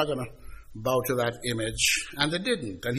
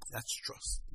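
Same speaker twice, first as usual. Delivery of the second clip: hushed whisper; 3 semitones lower, 13 dB quieter than usual. Real, not acted. Emphatic hushed aside to congregation.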